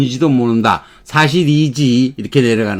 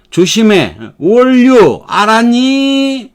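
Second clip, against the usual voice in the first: 짜증 내며; irritated warning; 8 semitones higher, much slower than usual. Shown as a demonstration. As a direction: snap the warning with annoyance. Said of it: "이렇게 하고 짜증을 내는 거죠".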